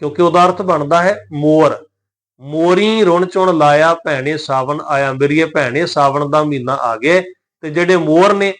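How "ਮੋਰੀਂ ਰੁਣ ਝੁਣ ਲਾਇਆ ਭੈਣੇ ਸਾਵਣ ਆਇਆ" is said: slow verse opening on morīṅ, ਮੋਰੀ nasalised; measured recitation, end ਮੋਰੀ through the nose. Shown as a demonstration. Teacher reading the monsoon verse, ਮੋਰੀ with nasal ending. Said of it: "ਉੱਥੇ ਬਿੰਦੀ ਸਹਿਤ ਸਾਨੂੰ ਪੜ੍ਹਨਾ ਪਏਗਾ".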